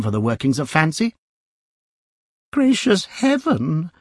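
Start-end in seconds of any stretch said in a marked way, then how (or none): 2.5-3.9 much higher, slow startled lady's voice. A narrator's reading. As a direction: gasp it like a frightened old lady. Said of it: "said the middle-aged lady".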